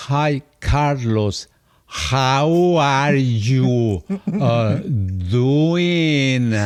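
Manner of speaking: very slow, exaggerated parody of a Mexican accent in English; drawling, sneering mimicry of a foreigner's English; mocking accent imitation. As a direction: mockingly stretch out every word of the greeting. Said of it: "me lo repetía burlándose".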